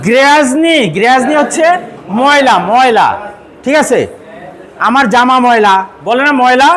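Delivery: ordinary talk, nothing marked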